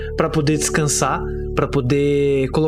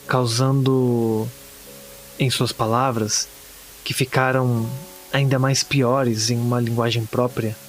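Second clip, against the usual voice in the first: grave, pausada horror narration; narração de conto de terror; 4 semitones lower than usual. Acted. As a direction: narrate a bleak horror tale, measured, with long pauses. (narração sombria de conto de terror)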